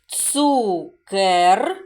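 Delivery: slow reading aloud. Shown as a demonstration. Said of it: "Давайте ми спробуємо прочитати слово".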